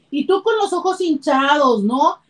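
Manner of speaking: ordinary delivery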